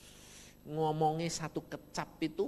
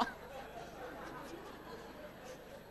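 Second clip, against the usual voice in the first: soft high laugh; breathy laugh; 13 semitones higher, 14 dB quieter than usual. Real, not acted. Preacher laughing at himself about children, softly. Laughing at his own remark.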